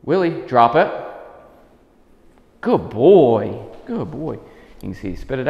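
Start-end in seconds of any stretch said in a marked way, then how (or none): 0.0-0.9 higher, louder call; name then a crisp order; firm command. Real, not acted. Trainer ordering Willie to spit out the stick, high and loud. 2.6-4.3 loud, bright praise; upbeat praise. Real, not acted. Trainer praising the dog for dropping it, loud and happy.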